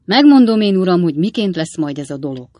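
serious and solemn. A narrator's reading. Sam telling his master how it will be, grave. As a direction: declare it firmly and gravely. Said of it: "komoly és ünnepélyes hangon".

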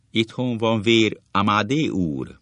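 slow, high and loud man calling through the door; man's voice outside. A narrator's reading. Husband at the door, calling in slow and loud. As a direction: call out from behind the door, drawn out and loud. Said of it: "kint egy férfi hang".

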